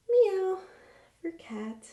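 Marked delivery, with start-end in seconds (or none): 0.1-0.6 high loud playful meow; cat meow. Shown as a demonstration. Teacher showing kids the cat sound, high playful meow. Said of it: "you can make animal sounds".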